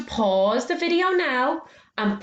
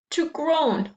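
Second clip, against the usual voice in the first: "groan" drawn out as a groaning moan; mock groan; 7 semitones higher than usual. Shown as a demonstration. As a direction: act out a groan on the word. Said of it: "that's a groan".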